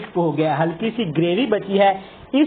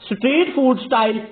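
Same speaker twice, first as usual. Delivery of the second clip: higher, lively channel host opening; upbeat show intro; 5 semitones higher than usual. Acted. Host welcoming viewers to his recipe channel, upbeat and higher. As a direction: greet viewers like a TV cook kicking off.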